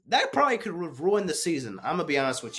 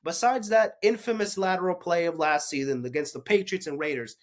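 normal talking voice

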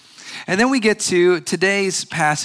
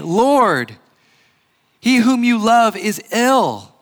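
higher, louder, slow; lightly voiced urgent plea; 5 semitones higher, 4 dB louder, slower than usual. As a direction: sisters urging Jesus to come. Voicing sisters' message.

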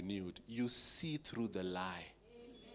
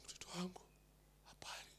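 whisper; 8 semitones higher, 17 dB quieter, faster than usual. Retold or imitated.

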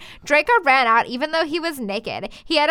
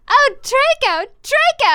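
high, loud mock-ecstatic cry; hammed-up scream; 13 semitones higher, 5 dB louder than usual.

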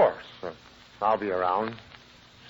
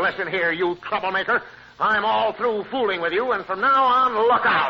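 rehearsed telling-off; 7 semitones higher than usual. Pretend. Rehearsing what he'll tell Gertie, a rising mock scolding. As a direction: practise scolding her, rising to a warning. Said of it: "I'll say to her".

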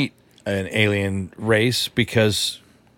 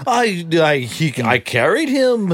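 higher, slower mock Shmi voice; hammed-up movie mother voice; Anakin's mother impression; 6 semitones higher than usual. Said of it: "That's a good impression".

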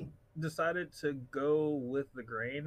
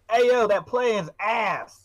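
loud, slow, jeering verdict; mocking shout; 8 semitones higher, 13 dB louder, much slower than usual. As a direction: bellow it like a heckler. Option C, a harsh listener's verdict.

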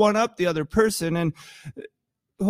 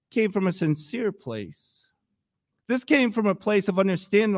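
ordinary delivery

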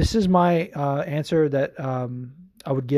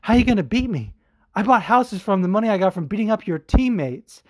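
imitating Dominic Cruz; 6 semitones higher, 4 dB louder than usual. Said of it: "He was like".

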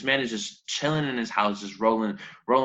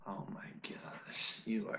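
whispering; 18 dB quieter, slower than usual. Real, not acted. Whispered amazement.

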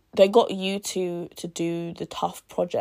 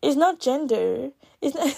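lightly voiced skeptic brushing it off; a hint of a scoffing doubter; playful imitation of a viewer denying bias; mock dismissive viewer; 5 semitones higher than usual. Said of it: "some of you guys will be like".

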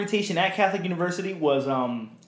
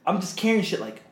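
ordinary talk, nothing marked

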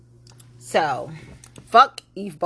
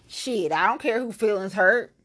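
ordinary talk, nothing marked

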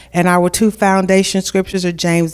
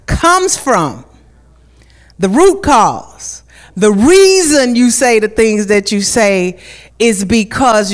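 preaching=107; 3 semitones higher, slower than usual. Acted. Preaching at full force.

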